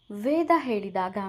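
plain reading voice

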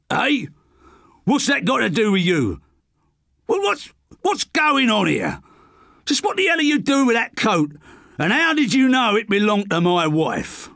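demanding answers, thrown off balance; startled husband voice; rattled Potter. Acted. Potter rattled by question about wife.

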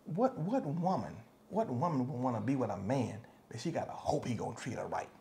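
hushed whisper. Real, not acted. Pastor confiding to the congregation, hushed whisper.

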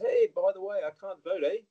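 put-on high, bright New Zealand accent; Kiwi accent. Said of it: "a Kiwi answers the door".

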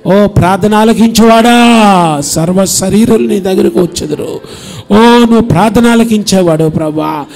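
loud, sung-out pastoral prayer; fervent prayer. Acted. Pastor praying loudly for the parents' children. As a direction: plead with God at full voice.

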